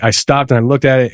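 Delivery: usual speaking voice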